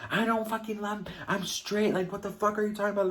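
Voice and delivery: high-pitched retold protest, a bit rushed; indignant denial. Boyfriend denying to the girlfriend, high indignant mock protest.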